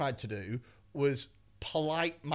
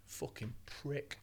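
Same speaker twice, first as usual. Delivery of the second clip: much softer, menacing snarl; low menacing threat; 4 semitones lower, 10 dB quieter than usual. Retold or imitated. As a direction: threaten him quietly. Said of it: "so he's like".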